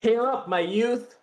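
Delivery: high, slow, put-on Crucian accent; a bad American try at a Crucian accent. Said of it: "American doing a Crucian accent".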